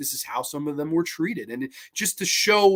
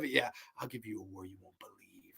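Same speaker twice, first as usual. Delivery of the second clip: low, much softer menacing movie-promo voice; Murnsey's take on the line; 8 semitones lower, 15 dB quieter than usual. Retold or imitated. Friend imitating the promo line, low and quiet and menacing. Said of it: "Murnsey beat me to it by saying".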